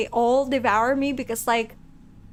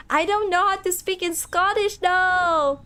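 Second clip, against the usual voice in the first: high-pitched, louder protest; 6 semitones higher, 3 dB louder than usual. Real, not acted. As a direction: protest helplessly with raised pitch. Protests being asked for Scottish accent.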